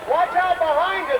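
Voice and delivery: high, urgent cry with a falling end; urgent warning. Acted. Announcer warns the performers behind them, high and urgent. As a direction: warn them before it's too late.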